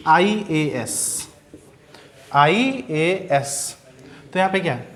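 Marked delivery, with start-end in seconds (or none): 0.1-1.3 IAS stretched very slowly, letter by letter; drawn-out example. Shown as a demonstration. Teacher giving IAS as an example, very slowly. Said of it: "जैसे अब हम बहुत सारे example देंगे". 2.3-3.8 IAS said very slowly, each letter held; drawn-out example. Shown as a demonstration. Teacher repeating IAS for the class, very slowly. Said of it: "उच्चारण भी देख लीजिए".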